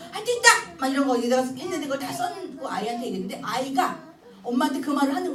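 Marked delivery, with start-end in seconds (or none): 0.1-0.5 high, loud shout of encouragement; rally the child, full voice; rallying cry. Shown as a demonstration. Cheering child on. (아이에게 큰 소리로 격려하며)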